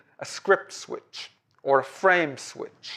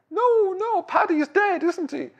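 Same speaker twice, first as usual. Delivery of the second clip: much higher, wailing mock-sobbing plea; crying wife. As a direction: pitch it way up as if breaking into tears. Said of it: "And she starts crying".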